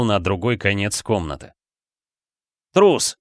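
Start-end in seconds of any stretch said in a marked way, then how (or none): 2.7-3.2 high, loud accusation; angry insult. A narrator's reading. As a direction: fling the word out in anger. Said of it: "бросил ему Флинн, источая гнев". (гневный выкрик)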